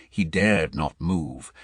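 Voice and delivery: ordinary delivery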